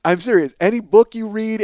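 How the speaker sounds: normal talking voice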